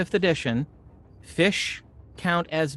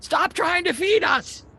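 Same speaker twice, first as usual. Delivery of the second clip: very high indignant fish cry; squeaky fish shout; 3 dB louder than usual. Acted. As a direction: shriek like an annoyed fish. DM fish voice, laughing.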